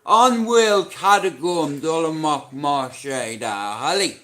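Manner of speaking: loud shouted names; calling out. Real, not acted. Streamer shouting out names to chat. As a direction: belt the names out.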